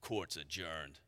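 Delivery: low, very soft, calm one-liner; calm Judge Dredd. Retold or imitated. Dredd calmly closing after the shootout, very soft and low. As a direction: drop it quietly like a closing verdict. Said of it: "he just calmly says".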